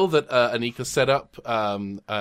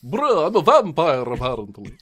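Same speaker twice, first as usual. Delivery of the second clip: vampire voice; 3 semitones higher, 4 dB louder than usual. Acted. Player jokes in character.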